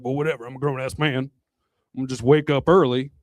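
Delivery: put-on low-pitched grumbling older man; older husband. Older husband answering his wife's food warning, low grumble. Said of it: "some older guy, you know".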